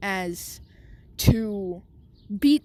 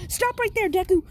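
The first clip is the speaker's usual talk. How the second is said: teacher's shout; 10 semitones higher than usual. Acted. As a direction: bark it like a teacher breaking up a fight. Teacher yells to stop fight.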